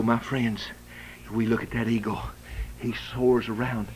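ordinary talk, nothing marked

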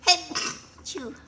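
plain reading voice

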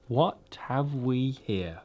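slow, hushed cold witch; menacing slow witch question. Acted. The witch speaks.

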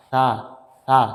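সা with the tongue tip on the upper teeth and a light breath, like English th in thin; Arabic tha sound in সা, soft and airy. Shown as a demonstration. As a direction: breathe out gently through সা, tongue touching the teeth. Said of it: "হালকা বাতাস বের করে".

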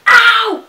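sudden high pained yelp; stubbed toe. Real, not acted. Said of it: "I really just stubbed my toe so hard".

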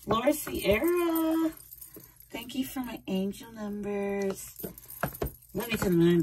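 high, slow, soft cooing thanks; sweet thanks. Real, not acted. Thanking viewer Laura for angel numbers, high soft coo. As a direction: coo your gratitude to a dear fan.

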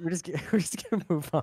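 words breaking into a real laugh. Real, not acted. Embarrassed player to chat, moving on while laughing. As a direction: laugh through it, hurrying past the moment. Embarrassed after losing at Clue.

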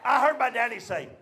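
usual speaking voice

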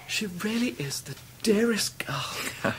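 slow tender whisper. Acted. Husband whispering fondly to a friend about his wife. Fond aside after wife leaves.

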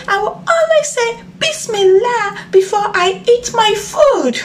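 mock crying, very high little frog; baby frog begging the crocodile not to eat him; high pleading frog. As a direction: beg for your life in a tiny voice.

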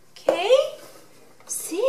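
normal talking voice

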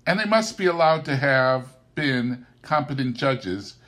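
ordinary delivery

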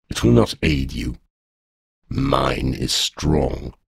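a giant beast in no hurry; menacing dragon. A narrator's reading. Dragon threatens captive.

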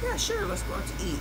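high mock agreeable friend; lightly voiced Tiffany. Friend Tiffany agreeing to plans, high mock voice. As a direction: chirp it like the flaky friend. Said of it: "Tiffany's reply voiced".

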